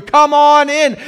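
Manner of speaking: loud, high, exuberant welcome; shouted invitation. Acted. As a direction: call them in at full voice. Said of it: "God says".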